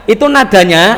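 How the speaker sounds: usual speaking voice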